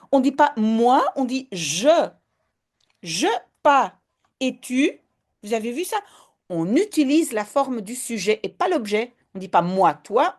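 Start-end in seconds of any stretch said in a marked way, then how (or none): none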